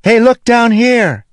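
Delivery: loud, very high, drawn-out call; Syl screaming. A narrator's reading. Syl running along the pier beside the ship, screaming up. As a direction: holler slowly up to someone far above. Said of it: "then screamed up at the ship".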